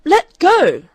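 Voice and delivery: high, loud, blazing cry; angry gasp. A narrator's reading. Daughter fighting father at the latch, high angry cry. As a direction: spit it at him in a blaze of anger. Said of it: "she gasped at him, a blaze of anger".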